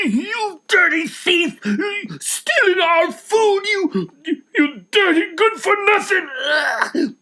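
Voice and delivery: high, loud, slow tearful rage; furious crying shout. Acted. Dussel throttling the thief, furious tearful shouting. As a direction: spit the insults through angry tears.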